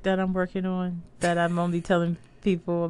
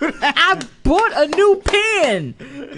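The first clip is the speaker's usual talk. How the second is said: laughing; 8 semitones higher, 7 dB louder, much slower than usual. Real, not acted. Laughing, changing the subject.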